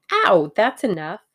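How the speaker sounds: loud yelp of protest; pained protest. A narrator's reading. Tuppence pinched by Tommy on request, loud protest. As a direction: cry out sharply, as if pinched.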